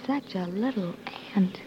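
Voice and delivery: teasing whisper with no voice. Acted. To Tom in the forest, a teasing whisper. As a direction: whisper it back playfully.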